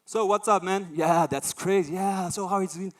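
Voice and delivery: loud, high, buddy-buddy greeting; hyped casual chat. Retold or imitated. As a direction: blurt it out like greeting a mate. Acts out switching to casual English.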